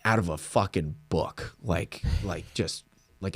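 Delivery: usual speaking voice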